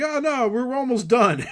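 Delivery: high, loud, hammed-up awkwardness; flustered protest. Friends in an awkward walk-in, loud mock fluster. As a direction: protest loudly as if caught in the act.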